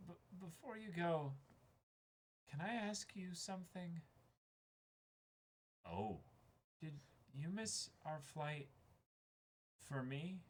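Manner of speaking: hushed, hesitant, slowed question; quiet, tender confession to a friend; soft character line. Acted. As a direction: ask it gently and shyly, almost under the breath. Reading a tender manga scene aloud.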